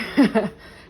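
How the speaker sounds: a bright, loud burst of laughter; quick giddy laugh; excited laugh. Real, not acted. Excited about new raw food start.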